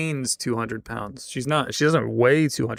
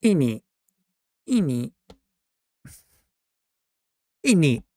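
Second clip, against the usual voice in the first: mock British innit; 4 semitones higher, 4 dB louder than usual.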